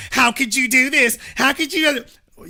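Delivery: imitating scandalized reporters demanding answers; high, incredulous questioning; outraged reporters. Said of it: "the media wasn't sitting there with their reporters".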